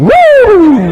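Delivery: very high, loud triumphant whoop; giddy whoop. Retold or imitated. Guy walking back to his car after the kiss, whooping. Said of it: "and he goes skipping".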